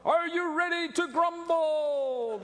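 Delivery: high, slow rally cry; rally the crowd to grumble; hype-man call. Retold or imitated. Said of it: "They loved to grumble".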